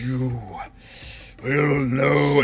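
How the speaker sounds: slow, drawn-out undead pronouncement; revenant voice. Acted. Revenant staring down Kales, slow and ominous. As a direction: stretch each word out ominously. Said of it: "it does not sound like Orvex at all".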